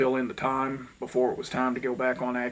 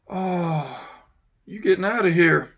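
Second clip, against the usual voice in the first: surprised lady; 5 semitones higher, 6 dB louder than usual. Retold or imitated. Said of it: "office lady reacts to him leaving".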